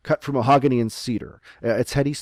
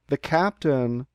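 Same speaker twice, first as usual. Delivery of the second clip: usual speaking voice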